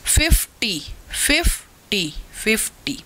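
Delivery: "Fifty" broken in two, फिफ्-टी, with a gap; teacher sounding out both syllables of "fifty"; split into syllables. Shown as a demonstration. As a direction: repeat "fifty" as two separate beats. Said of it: "जिनमें दो syllables होंगे".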